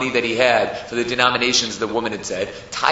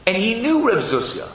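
usual speaking voice

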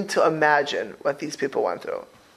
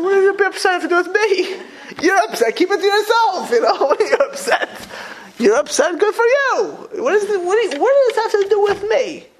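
exasperated scolding. Retold or imitated. Rabbi retells scolding his kid.

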